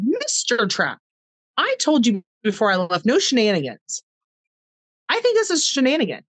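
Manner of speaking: mock-stern teasing, rising high at the end; playful scolding. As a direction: scold him lovingly, like a wink. Teasing her dying husband gently.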